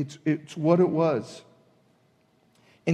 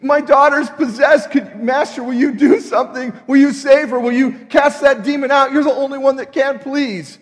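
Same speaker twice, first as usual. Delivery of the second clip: higher and louder, pleading mother; mother cries out, begging Jesus to heal her daughter; desperate begging; 7 semitones higher, 9 dB louder than usual. Retold or imitated. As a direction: beg him to save your daughter. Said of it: "who's begging him".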